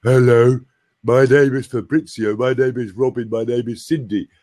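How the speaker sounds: overdone, sustained open-throat voice; hammed-up voice-training delivery; exaggerated exercise voice. Shown as a demonstration. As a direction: intone each name like a vocal exercise. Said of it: "I don't expect people to go".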